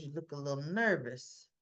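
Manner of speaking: hint of a cold gangster; menacing mob boss. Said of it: "he goes".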